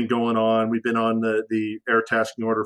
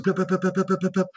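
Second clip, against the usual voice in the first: imitated tones; 7 semitones higher, faster than usual. Mimicking targeting tones.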